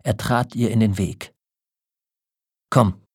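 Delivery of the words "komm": short, louder, impatient burst; impatient gasp. A narrator's reading. Old man stops his wife in her path, gasped impatiently. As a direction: pant it out impatiently. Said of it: "keuchte er ungeduldig".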